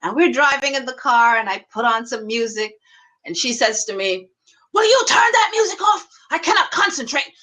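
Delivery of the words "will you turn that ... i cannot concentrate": mock a driver losing patience; shrieky retold complaint, pitched right up; imitated irritated friend.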